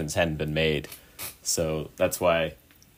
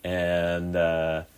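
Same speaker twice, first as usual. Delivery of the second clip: normal talking voice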